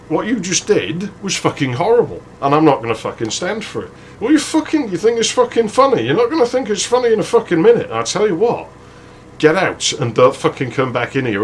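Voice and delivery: indignant telling-off, pitch jumping high mid-rant; acted angry confrontation. Man confronting mates who made her cry, acted anger. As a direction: tear into them, heated but not shouting.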